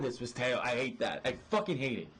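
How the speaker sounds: ordinary talk, nothing marked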